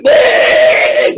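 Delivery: high, loud choking gasp; shark gasp. Pretend. Shark trapped in the duvet gasps for breath, high and loud. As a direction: choke out a gasp like a stranded fish. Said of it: "That poor shark would be going".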